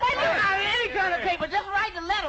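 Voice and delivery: high-pitched yell over bickering friends; exasperated shout. Acted. Boy telling squabbling friends to write the letter, exasperated shout. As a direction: yell to be heard, fed up.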